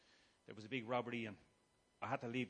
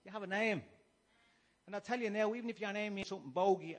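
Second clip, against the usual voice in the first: louder, higher, preaching at full force; fiery preaching; 6 semitones higher, 6 dB louder than usual. Acted. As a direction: rouse the congregation like a preacher. Preaches to the congregation.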